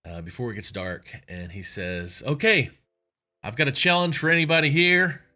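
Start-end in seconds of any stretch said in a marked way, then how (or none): 2.3-5.2 loud, high Texan party host calling out a challenge; booming rich Texan host. Retold or imitated. Rich Texan challenges his guests, loud showman. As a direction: announce the dare to the whole party.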